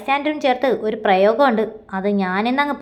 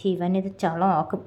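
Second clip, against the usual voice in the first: slow, much softer aside under the breath; said to herself; 5 semitones lower, 7 dB quieter, slower than usual. Acted. Minnu worrying her friend will ruin the act, soft slow aside. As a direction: mutter it to yourself, slowly. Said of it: "മിന്നു സ്വയം പറഞ്ഞു".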